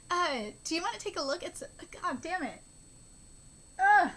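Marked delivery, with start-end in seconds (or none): none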